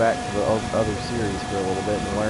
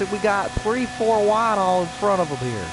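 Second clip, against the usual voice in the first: race commentator; 7 semitones higher than usual. Acted. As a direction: hype up the finish like TV commentary. Race play-by-play.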